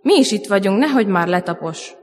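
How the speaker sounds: ordinary talk, nothing marked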